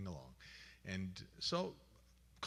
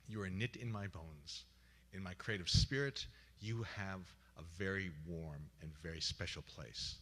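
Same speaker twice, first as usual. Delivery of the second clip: sincere tribute; 6 semitones lower, 4 dB quieter than usual. Retold or imitated. Tribute told to dying friend.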